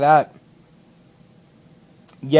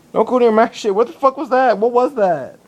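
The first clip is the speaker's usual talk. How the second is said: higher-pitched, creeped-out alarm; real freak-out; 7 semitones higher than usual. Real, not acted. Vlogger warning a weird bug away from their stuff, freaked out. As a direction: warn the thing off, rattled and incredulous.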